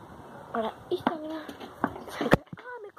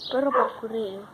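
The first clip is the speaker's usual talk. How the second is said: ordinary talk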